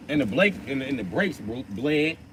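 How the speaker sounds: stumbling over the words, restarting; tongue-tied. Real, not acted. Man listing truck repairs to a visitor, tongue-tied. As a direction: trip on the similar sounds and correct yourself. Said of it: "I don't know why I'm so tongue-tied".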